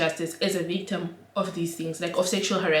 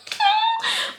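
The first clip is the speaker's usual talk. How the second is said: squeaky high laugh. Real, not acted. Realizing man was drunk, high squeaky laugh. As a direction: laugh at own folly.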